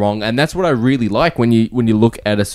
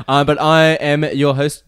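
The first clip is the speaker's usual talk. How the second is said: ordinary talk, nothing marked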